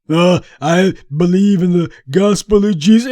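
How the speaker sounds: lightly voiced sanctimonious mimic; a hint of put-on piety; mock pious voice. Commentator mimicking the player's religious statement, mock pious. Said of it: "you weren't talking like this last week".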